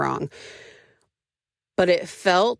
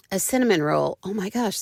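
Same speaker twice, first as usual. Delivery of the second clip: ordinary talk, nothing marked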